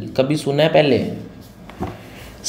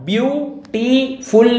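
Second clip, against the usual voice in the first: Beautiful high and slow in three beats; beautiful broken into three emphatic syllables; 6 semitones higher, much slower than usual. Shown as a demonstration. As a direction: sound out beautiful as BYOO, TEE, FUL. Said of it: "तीन बार ही तो हो रहा है".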